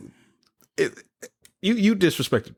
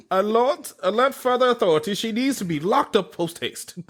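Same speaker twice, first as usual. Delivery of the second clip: mock British accent; 7 semitones higher than usual. Joking about the post office boss in a mock British accent. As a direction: play a pompous British constable raising the alarm.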